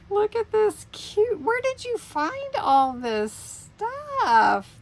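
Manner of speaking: high, slow gushing delight. Real, not acted. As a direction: coo over something cute. Delighted at cute gift.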